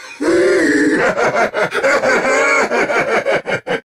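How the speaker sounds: long villainous cackle; evil laugh. Acted. Villain gloating over a new world, long evil laugh. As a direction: let a triumphant evil laugh roll on.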